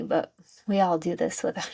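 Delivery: usual speaking voice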